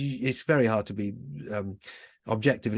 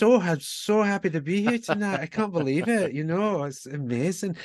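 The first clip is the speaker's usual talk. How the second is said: put-on higher-pitched, gushing Scottish accent; generic Scottish accent; 8 semitones higher than usual. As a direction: imitate a delighted Scottish singer greeting her audience. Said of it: "that was just my generic Scottish accent".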